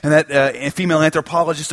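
normal talking voice

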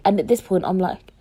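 ordinary delivery

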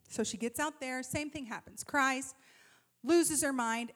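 usual speaking voice

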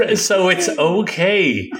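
slow, dry sarcastic echo. Acted. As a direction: drag it out, not buying it. Echoing sarcastically, slowly.

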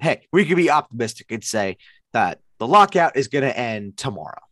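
normal talking voice

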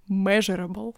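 "measurable" with a Russian accent, rolled r; English "measurable" said the Russian way. Shown as a demonstration. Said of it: "давайте я буду говорить её с русским акцентом".

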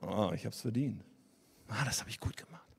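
very soft, low self-satisfied murmur; hushed, pleased talking to oneself; inner self-congratulation. Acted. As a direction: murmur it privately, as a smug inner thought. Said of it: "innerlich tief drin ist es dann sowas wie".

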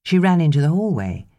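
ordinary delivery, nothing marked

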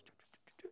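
voiceless, very soft choo-choo patter; whispered trot noises; trotting sound. Acted. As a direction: imitate little hooves trotting off under your breath. Imitates donkey trotting along.

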